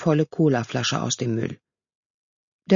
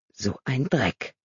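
slow, soft disgusted thought; a slow quiet thought of disgust; inner thought; 3 semitones lower, 5 dB quieter, slower than usual. A narrator's reading. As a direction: mutter it to yourself in mild disgust. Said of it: "dachte Maria".

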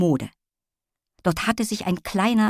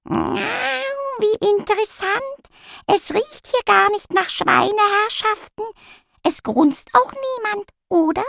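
very high, slow, grand lady cat; haughty cat voice; slower than usual. A narrator's reading. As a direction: purr it out like a posh cat. Cat character speaks.

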